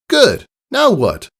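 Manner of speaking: high, bright coaching praise; Rainbow Dash voice. A narrator's reading. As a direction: praise and push for the next step. Dash praises, prompts filly.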